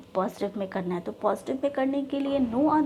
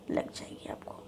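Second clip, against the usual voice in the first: whisper; 11 dB quieter than usual. Real, not acted. Aside to students.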